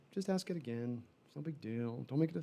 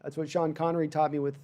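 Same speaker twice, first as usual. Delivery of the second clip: ordinary talk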